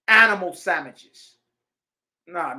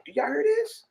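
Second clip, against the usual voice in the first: much higher, excited question; excited preacher's call. Real, not acted. Preacher asking his listeners if they hear it, high and excited. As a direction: lift the question up high to the crowd.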